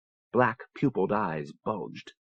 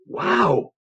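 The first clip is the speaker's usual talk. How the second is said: wow, thrilled and higher; amazed exclamation; excited; 6 semitones higher than usual. Acted. Creature crashes, Renner reacts.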